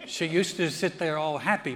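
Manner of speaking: ordinary talk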